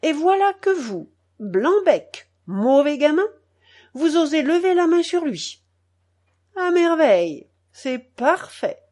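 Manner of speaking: high, loud, indignant outburst; angry scolding. A narrator's reading. Grandmother scolds the boy angrily.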